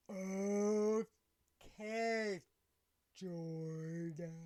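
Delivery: hugely drawn-out words with long gaps; buzzed slurring. Retold or imitated. Buzzed woman agrees to stay calm, exaggerated slow slur. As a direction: imitate someone too buzzed to form words. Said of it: "very buzzed Angie".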